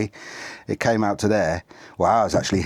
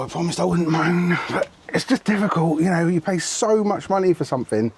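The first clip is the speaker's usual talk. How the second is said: moaning; 7 semitones higher than usual. Real, not acted. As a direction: whinge about it, fed up. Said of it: "I've got to stop moaning".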